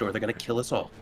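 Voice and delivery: ordinary talk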